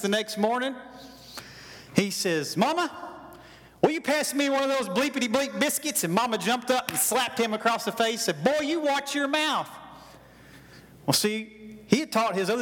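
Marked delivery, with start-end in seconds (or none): 2.6-6.0 put-on higher, rising boy's voice blurting it out; cocky boy demanding biscuits; blunt demand. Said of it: "he didn't ask nicely". 8.4-9.7 higher, slower mock-stern mom warning; hammed-up mother's rebuke; stern mother scolding. Mama slaps son for cursing.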